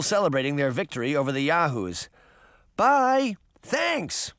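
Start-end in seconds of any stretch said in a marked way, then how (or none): 2.8-4.3 high, cheery farewell call; little girl's high parting shout. A narrator's reading. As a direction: call goodbye to friends at a distance. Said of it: "Daphne called".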